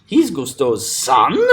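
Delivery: slow, incredulous question landing on "son"; rising, punched "son"; stress on SON. Shown as a demonstration. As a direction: stretch and lift "son" as a question. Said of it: "That's what you wanna stress".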